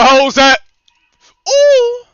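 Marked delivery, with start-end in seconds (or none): 1.5-2.0 high impressed ooh. Real, not acted. Reacting to play.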